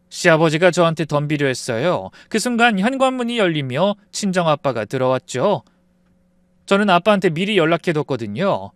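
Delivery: ordinary delivery, nothing marked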